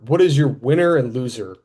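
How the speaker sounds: usual speaking voice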